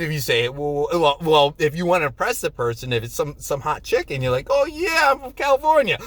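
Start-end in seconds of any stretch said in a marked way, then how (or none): 4.5-6.0 high, loud put-on boast; playful mock bragging. Newcomer trying to impress someone he likes, mock bragging. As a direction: show off in a much higher voice.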